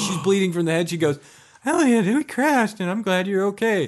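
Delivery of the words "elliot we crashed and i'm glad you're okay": Lisa's relief. Retold or imitated. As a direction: draw it out, high and relieved. Lisa waking him after crash.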